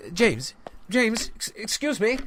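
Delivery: rattled, calling after him; startled. Acted. Editor calling after James as he leaves, startled and high. Visitor suddenly acts strangely.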